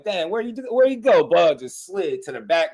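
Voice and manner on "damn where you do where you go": puzzled. Retold or imitated. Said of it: "you'll be like".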